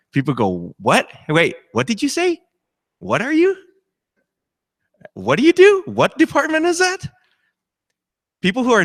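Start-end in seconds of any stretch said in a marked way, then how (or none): 0.8-7.1 high, incredulous mock questioning; bewildered. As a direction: squeak it out in disbelief. Chaplain mimics bewildered non-Christians.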